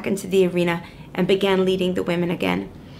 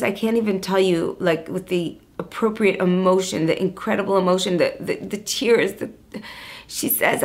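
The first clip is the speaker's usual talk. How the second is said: ordinary talk, nothing marked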